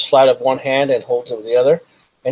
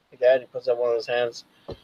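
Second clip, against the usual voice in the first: ordinary talk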